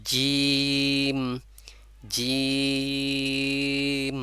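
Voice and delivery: জিম held long for three alifs, lips wide, not rounded; Arabic letter jeem drawn out. Shown as a demonstration. Teacher showing students the letter, জিম drawn out long. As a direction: stretch জিম slowly with the tongue middle touching the palate. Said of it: "যেমন".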